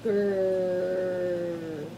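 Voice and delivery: low drawn-out growl; low rumbling growl at the mouse; lion growl. Acted. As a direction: growl like a big cat. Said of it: "and growled".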